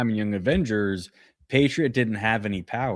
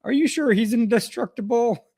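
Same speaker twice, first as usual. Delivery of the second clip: mock agent question; 13 semitones higher, 3 dB louder than usual. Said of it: "the guy's like".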